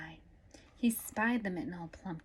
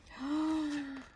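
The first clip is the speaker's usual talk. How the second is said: hushed gasp; 7 semitones higher than usual. Acted. Reader reacting before the sneeze.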